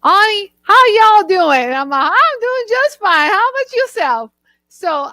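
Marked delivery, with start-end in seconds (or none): 0.0-1.6 loud, high Southern greeting; Southern accent. Retold or imitated. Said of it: "I have a lot of Southern friends". 2.1-4.3 high, playful Southern lilt; Southern accent. Retold or imitated. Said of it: "I have a lot of Southern friends".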